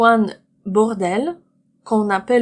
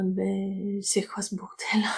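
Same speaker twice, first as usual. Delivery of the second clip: whispered example; 8 dB quieter than usual. Shown as a demonstration. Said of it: "quiet example".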